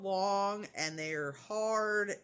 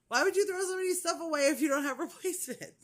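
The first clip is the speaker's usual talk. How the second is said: high, fast incredulous protest; retold exasperation; 9 semitones higher, 5 dB louder, faster than usual. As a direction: fire it off higher and faster, half laughing. Retold exasperated retort to nurse.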